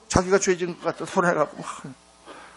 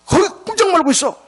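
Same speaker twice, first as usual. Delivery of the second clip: much higher, louder "stay right there"; urgent order; 13 semitones higher, 5 dB louder than usual. Retold or imitated. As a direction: pin her to the spot with the order.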